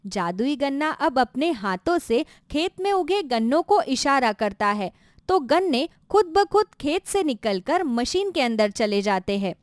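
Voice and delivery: ordinary delivery, nothing marked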